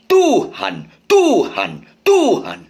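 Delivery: Tuhan with the first syllable tuh hit high; stress on tuh. Shown as a demonstration. Preacher showing songwriters where Tuhan's stress falls, first syllable punched. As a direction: land hard on tuh, the second syllable light. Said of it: "ketukan ketiga".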